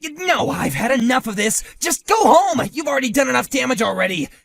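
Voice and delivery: very loud, high, fast yell; furious shouting. Acted. Husband ordering the rival to go home, furious shout. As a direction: drive him off the property.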